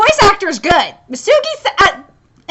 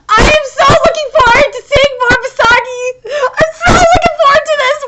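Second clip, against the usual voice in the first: high, loud, gushing; eager excitement; 7 semitones higher, 4 dB louder than usual. Real, not acted. Fan eager for Misagi content, excited high gushing. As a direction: gush it like you can't sit still.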